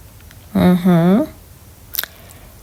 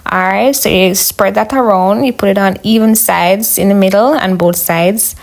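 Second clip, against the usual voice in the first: ordinary talk, nothing marked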